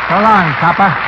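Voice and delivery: hammed-up mobster farewell; tough gangster. [name] plays Benny the Bomber.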